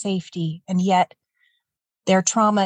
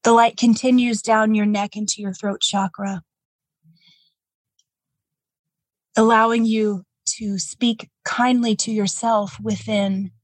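calm, gentle meditation guide; guided meditation. Acted. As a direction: ease the listener into calm.

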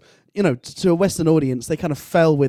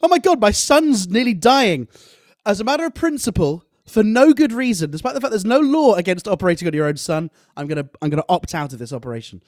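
mock mother; 6 semitones higher, 4 dB louder than usual. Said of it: "what an absolute dick move by the mother to go".

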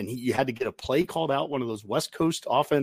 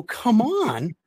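higher, pleading come on, stop doing this; a fan groaning at his own team's penalties; frustrated fan pleading with his team; exasperated plea; 7 semitones higher, much slower than usual. Retold or imitated. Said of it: "you sit there and you go".

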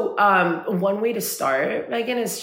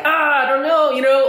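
higher-pitched exasperated outburst; mock overwhelmed; 9 semitones higher, 3 dB louder than usual. Friend voices women overthinking their feelings, mock overwhelmed. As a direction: throw it up high, as if overthinking everything.

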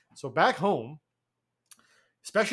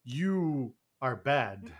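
very slow, low, softer deadpan; dubbed action hero; 5 semitones lower, 5 dB quieter, much slower than usual. Retold or imitated. Portuguese dub of the Cobra threat, mock-solemn slow delivery. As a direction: space out each word, low and solemn. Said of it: "he goes".

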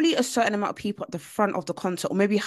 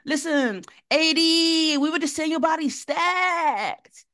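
put-on high, bright American girl voice; mock American cool girl; American accent; 9 semitones higher, slower than usual. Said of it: "your American accent is too funny".

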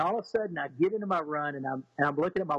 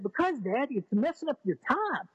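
higher, lightly voiced young girl; voicing his daughter; 7 semitones higher than usual. Dad retells daughter explaining to him, in a higher girlish voice.

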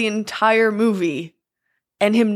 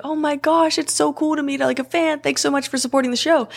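gushing sweetness; 7 semitones higher, faster than usual. Retold or imitated. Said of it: "She's like".

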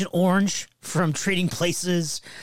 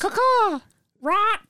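chirping bird person; much slower than usual. Pretend. Pterodactyl toy squawking.

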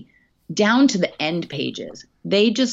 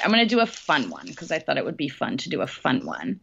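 normal talking voice

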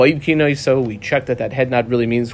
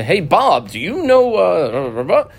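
lightly acted call to Bob, rising high; acted questioner; 4 semitones higher, 3 dB louder, much slower than usual. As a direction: casually hail someone with a high-pitched question. Acted request to a witness.

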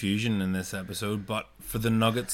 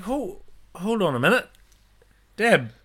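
mock surprise; 10 semitones higher, 4 dB louder than usual. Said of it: "It's like".

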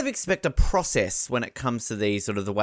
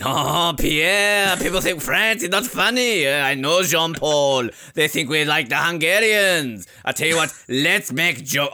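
French comedian. Retold or imitated. Mocking French comedians to a co-host, light French accent. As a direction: play a hammy French joker in a light French accent. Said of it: "the French comedian".

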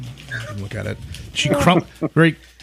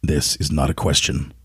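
low, measured radio-presenter read; 7 semitones lower than usual. Pretend. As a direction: drop low and read it like a broadcaster. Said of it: "I'll do it radio voice".

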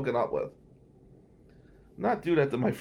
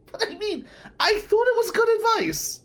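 mock innocent; 4 dB louder than usual. Real, not acted. Defensive comic aside.

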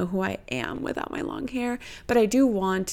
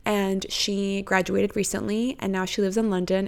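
ordinary talk, nothing marked

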